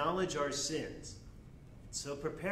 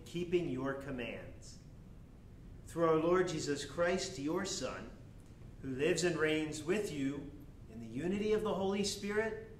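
solemn prayer; 4 semitones higher than usual. Acted. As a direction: pray it aloud before the congregation. Priest prays collect.

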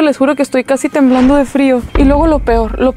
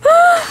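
sudden high shriek of fright; a short, sharp scream of shock; startled scream. Real, not acted.

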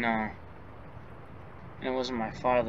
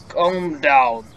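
louder, firm command to calm down; 8 dB louder than usual. Acted. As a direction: say it loud to cut through panic. Calming a panicking friend.

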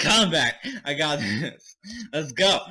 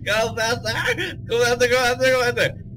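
high, wailing panicked cry; frantic panic; 7 semitones higher than usual. Real, not acted. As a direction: plead tearfully, high and frantic. Trapped, fleeing in the game.

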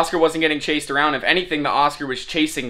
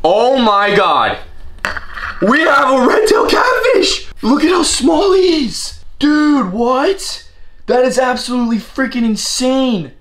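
high, loud amazed shouting with laughter; excited shock; 6 semitones higher, 4 dB louder than usual. Real, not acted. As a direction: blurt it out in sheer disbelief. Surprise at catfish in pod.

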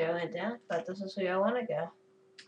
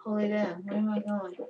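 ordinary talk, nothing marked

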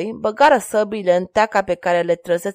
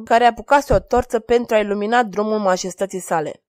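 ordinary delivery, nothing marked

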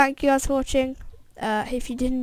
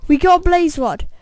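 excited cheer; 6 semitones higher, 8 dB louder than usual. Real, not acted. Getting the blaze rod.

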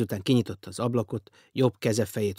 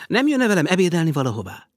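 higher, louder, merry invitation; increasingly cheerful; 8 semitones higher, 8 dB louder than usual. A narrator's reading. Millionaire invites the strange guest to lunch, cheerful and bright. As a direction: invite him gleefully. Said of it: "kérdezte egyre vidámabban mister Teo".

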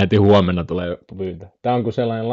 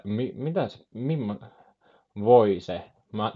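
ordinary delivery

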